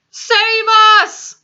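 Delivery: high, loud cry for help; terrified scream. A narrator's reading. Nightmare-stricken giant begging rescue, terrified scream. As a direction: scream it at full voice. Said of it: "screamed the flesh lump eater".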